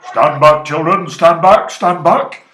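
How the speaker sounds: put-on an elephant roaring a warning to the children; booming shout. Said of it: "deep voice from the jungle shouted".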